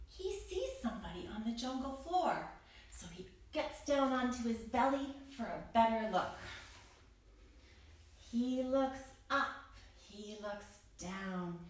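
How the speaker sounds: ordinary delivery, nothing marked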